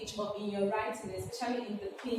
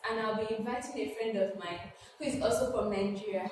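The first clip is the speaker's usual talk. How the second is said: ordinary talk, nothing marked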